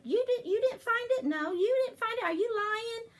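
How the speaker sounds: put-on high, loud childish accusation; upset kids accusing. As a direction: whine it like a suspicious kid. Kids accusing David about egg.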